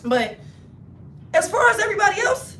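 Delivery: ordinary talk, nothing marked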